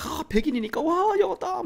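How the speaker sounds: 높은 목소리로 과장된 감탄 흉내; 호들갑 떠는 감탄. Acted. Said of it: "무조건 다 좋다고 막". (호들갑스럽게 감탄하는 흉내)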